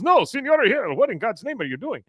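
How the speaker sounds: high, alarmed mock outcry; startled protest. Man surprised naked in the shower, high startled protest. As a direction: imitate a man caught off guard, pitch leaping.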